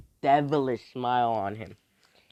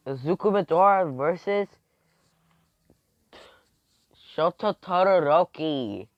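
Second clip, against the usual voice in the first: loud announcer call, pitched up; match announcer; 4 semitones higher, 7 dB louder than usual. Acted. Announcing the next fight, loud match announcer. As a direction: call out the fighters' names loudly.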